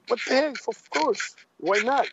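high-pitched retold eagerness; eager acceptance. Wrestler retelling his answer to a game offer, eagerly accepting. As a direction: reenact jumping at an offer, pitched well up.

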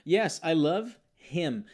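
very slow, clear yes I love him; slow correct model. Shown as a demonstration. Teacher models the correct answer slowly. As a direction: spell it out gently. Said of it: "Poderia ser I love it aqui?".

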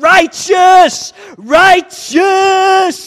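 loud, high surfer shout; whooping "Righteous!" at full volume; surfer yell. Retold or imitated. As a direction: yell it like a thrilled surfer on a huge wave. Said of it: "Crush keeps yelling out".

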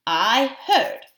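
ordinary delivery, nothing marked

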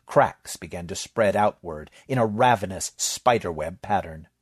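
plain reading voice

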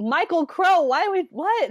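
high, loud baffled cry; can't believe it; incredulous outburst. Real, not acted. Outraged at the decision.

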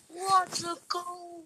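slow, drawn-out commentator call; sports commentator voice. Pretend. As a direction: announce it like a TV commentator. After scoring.

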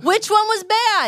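high, drawn-out demand; indignant challenge. Real, not acted. Host challenges co-host who said no, indignant challenge. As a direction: challenge it with pitch leaping up, slow.

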